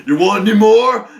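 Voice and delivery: high, loud villain's challenge; taunting shout. Retold or imitated. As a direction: imitate a hostage taker's loud taunt. Hostage taker taunting police.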